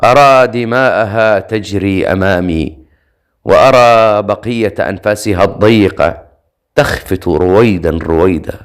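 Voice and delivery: ordinary delivery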